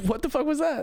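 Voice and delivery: high startled what-the-fuck; surprised. Real, not acted. Reacting to friend's weird noise.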